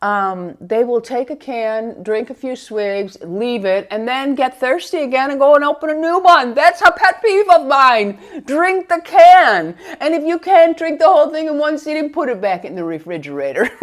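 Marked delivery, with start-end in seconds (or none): 5.0-13.7 exasperated scolding. Real, not acted. Scolding family over half-drunk cans.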